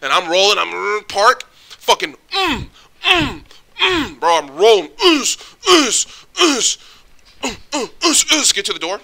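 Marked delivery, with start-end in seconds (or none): 2.3-8.5 sound effect. Retold or imitated. Imitating sliding car.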